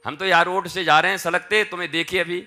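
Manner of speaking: loud rustic calling voice from the roadside. Acted. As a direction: call out to the old man below. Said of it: "आवाज दिया".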